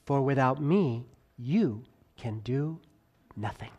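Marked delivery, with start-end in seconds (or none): 1.4-3.7 "you can do nothing" slowing, sinking low and soft on "nothing"; fading emphasis. Shown as a demonstration. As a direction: let "nothing" fall away low and quiet. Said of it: "voice fluctuation ... to emphasize".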